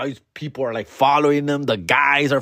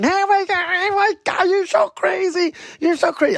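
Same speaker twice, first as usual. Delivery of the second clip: put-on much higher, squealing party girls; giggly drunk girls. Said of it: "they're like, they're laughing".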